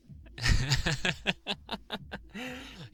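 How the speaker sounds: high gleeful teasing laugh; mocking laugh. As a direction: laugh down at them with delight.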